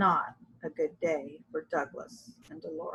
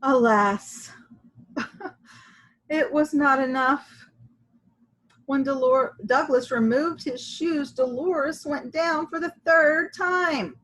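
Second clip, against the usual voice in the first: ordinary delivery, nothing marked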